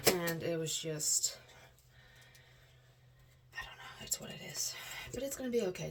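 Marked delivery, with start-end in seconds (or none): 3.5-4.7 barely whispered, giving up; whispered resignation. Real, not acted. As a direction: let it fall to a resigned whisper. Low moment, drained.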